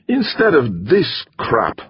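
put-on high, complaining foreign-accented grumble; grousing Hungarian. A narrator's reading. Torok grousing about the coffee, accented. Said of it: "the Hungarian groused".

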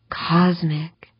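whispered in awe; breathed whisper. Acted. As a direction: breathe the word out, no voice. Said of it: "she breathed".

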